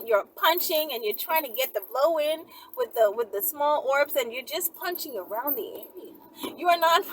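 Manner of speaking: ordinary delivery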